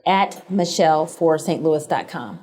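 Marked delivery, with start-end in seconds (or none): 0.1-1.4 slow, careful dictation; measured dictation pace, falling at the end. Real, not acted. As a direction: spell out the address slowly, word by word. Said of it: "Say that again very slow".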